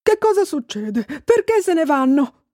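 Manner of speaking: high, bewildered outcry; thundering astonishment. A narrator's reading. Babbo Natale watching his reindeer leave, astonished. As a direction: exclaim it in stunned disbelief. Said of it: "tuonò sbalordito".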